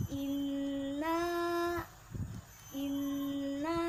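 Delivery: inna with the nn held long; long nun. Shown as a demonstration. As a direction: stretch the nn in inna. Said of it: "nunnya itu dibaca panjang ya, bukan pendek".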